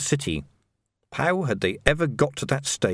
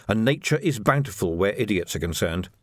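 usual speaking voice

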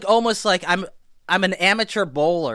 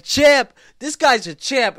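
louder, higher mock call to the room; imitating loud greeting; 8 semitones higher, 7 dB louder, slower than usual. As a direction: announce him to the whole queue. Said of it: "He's like".

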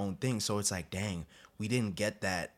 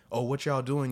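mildly raised, louder mimicked question; curious onlookers; 6 semitones higher, 6 dB louder than usual. Other dudes approaching players at flag practice, curious call. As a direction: call out like a nosy passerby. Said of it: "dudes would come out there and be like".

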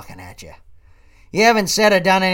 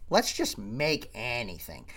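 mocking quote; 6 semitones lower, 9 dB quieter, slower than usual. Mocks a woman's favorite phrase.

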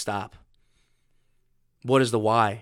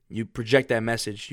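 ordinary delivery

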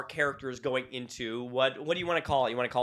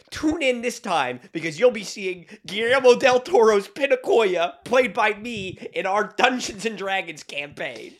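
louder, slow hammed-up trailer announcer; mock trailer voiceover; 5 semitones higher, 7 dB louder, slower than usual. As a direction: sell it like a movie trailer.

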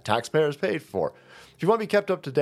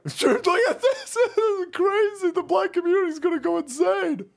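laughing in disbelief. Real, not acted. Laughing again at the footage.

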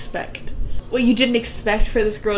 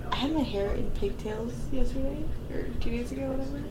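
ordinary talk

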